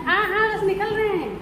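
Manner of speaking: high, carrying reassurance; calling back. Real, not acted. Answering someone waiting to leave, calling back in a high voice. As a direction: call out that you're on your way.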